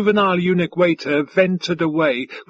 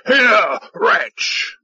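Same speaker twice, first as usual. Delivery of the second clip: high, loud summons; imperious alien officer's shout; bellowed; 5 semitones higher, 4 dB louder than usual. A narrator's reading. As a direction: bellow for a servant with contempt. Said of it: "he bellowed at a hovering juvenile eunuch".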